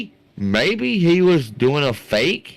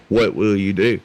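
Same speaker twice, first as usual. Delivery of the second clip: lower, knowing announcer tease; low teasing promo line; 5 semitones lower than usual. Acted. Caster teasing viewers before the reveal, low promo voice. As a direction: drop it down like a trailer voice.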